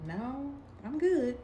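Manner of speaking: slow, high, sing-song "No, I'm good"; sweet brush-off. Retold or imitated. Refusing the date's kiss at the car, slow sweet brush-off. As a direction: turn him down with a fake-polite smile.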